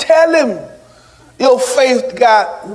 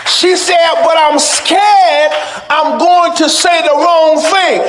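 raised, ringing sermon delivery; full-force preaching; 7 semitones higher than usual. Real, not acted. Preacher tells the church how hard forgiving is, full-force preaching. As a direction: preach it high and forceful to the congregation.

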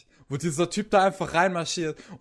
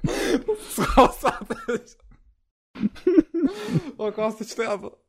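laughing; 8 semitones higher, slower than usual. Real, not acted. Dying of laughter.

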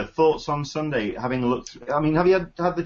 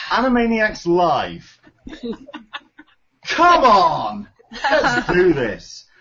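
excited laughing; 8 semitones higher, 5 dB louder, much slower than usual. Real, not acted. Hyped about favorite panel.

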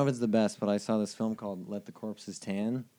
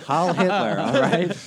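mock cheer; 6 semitones higher, 8 dB louder, slower than usual. Mock fascist cheer, laughing.

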